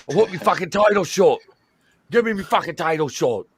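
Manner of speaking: loud, high demand for a title shot; demanding wrestler. Retold or imitated. Wrestler yelling at the boss for his title shot, loud. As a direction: yell it like an angry wrestler.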